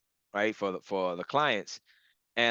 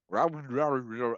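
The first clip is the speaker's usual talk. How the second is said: quick slurred nonsense babble; imitated gibberish. Client mimicking the sound in the audio, gibberish. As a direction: babble it like garbled speech. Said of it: "gibberish type thing".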